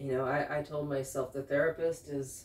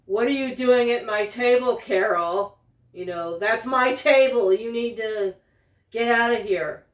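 loud, raised-pitch territorial scolding; voicing the glaring man, much louder and higher; imagined angry glare; 5 semitones higher, 10 dB louder than usual. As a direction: bark it like he owns the table. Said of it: "glaring at me as if to say".

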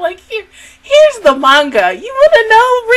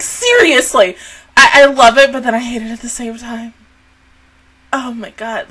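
ordinary talk, nothing marked

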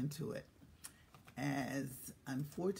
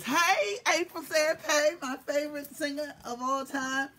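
loud high welcome; excited greeting; 7 semitones higher, 4 dB louder than usual. Real, not acted. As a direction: greet her with delight. Greeting a viewer.

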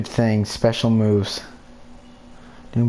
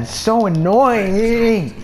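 exasperated whine; 10 semitones higher, slower than usual. Real, not acted. Complaining during fight.

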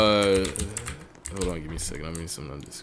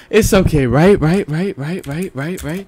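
high, loud start, then nervous repetition; frantic self-reassurance; 6 semitones higher, 7 dB louder than usual. Real, not acted. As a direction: plead for agreement, over and over. Frantically reassuring self after loss.

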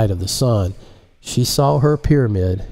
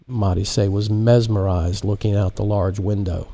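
ordinary delivery, nothing marked

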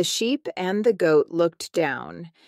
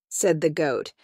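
usual speaking voice

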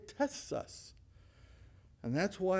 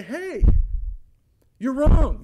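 much louder, high rebuke; 7 semitones higher, 13 dB louder, slower than usual. Acted. Preacher voicing Paul's warnings to the church, loud rebuke. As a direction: hit it like a hammer.

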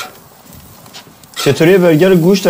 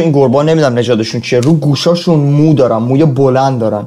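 ordinary delivery

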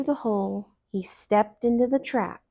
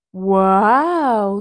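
Brer Bear; 7 dB louder than usual. Acted. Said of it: "said Brer Bear".

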